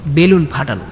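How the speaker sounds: plain reading voice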